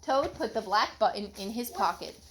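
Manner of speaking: usual speaking voice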